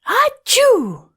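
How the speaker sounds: very high, big acted sneeze; a huge cartoon achoo; gigantic sneeze. A narrator's reading. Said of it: "The snowman sneezed a giant".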